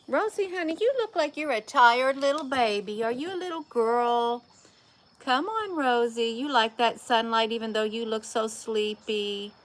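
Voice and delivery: doting high voice for a tired kitty. Real, not acted. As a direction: coo at the sleepy cat. Cooing to the sleepy cat.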